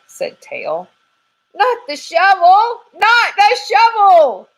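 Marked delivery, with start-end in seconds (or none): 1.5-4.5 much higher and louder slow panicked yell; panicked squirrel. Acted. Squirrel panicking about the shovel, yelling much higher and louder. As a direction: scream like the shovel is coming for you.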